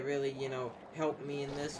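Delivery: normal talking voice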